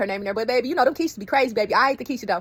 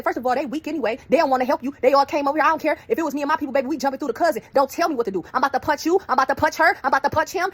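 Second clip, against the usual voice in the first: higher, louder heated warning, building; heated threat; 6 semitones higher, 5 dB louder than usual. Retold or imitated. Heated quote to the other family.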